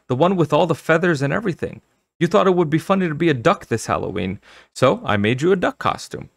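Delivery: high, fast, enthusiastic mother voice; eager mother. Acted. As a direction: rush through it eagerly, pitched high.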